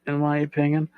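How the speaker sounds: ordinary talk, nothing marked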